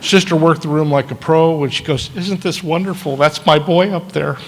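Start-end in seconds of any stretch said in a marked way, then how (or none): 2.1-4.4 proud delight. Retold or imitated. Proud nun at his wedding.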